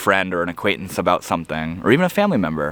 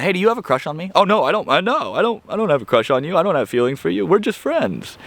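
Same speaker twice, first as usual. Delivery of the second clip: flustered denial; 8 semitones higher, faster than usual. Acted. Acted denial of a crush.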